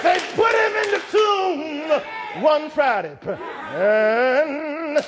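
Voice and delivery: rising sermon cadence, hymn lines shouted; fervent pulpit delivery; preaching at full force. Acted. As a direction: preach it, building to a shout on how great thou art. Climactic sermon close, whooping.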